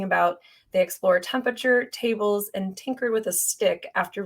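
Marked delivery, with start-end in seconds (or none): none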